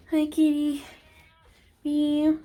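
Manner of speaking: high, sing-song hello to a cat; talking to cat. Real, not acted. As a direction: greet a cat in a high pet voice. Greeting the cat, meowing back.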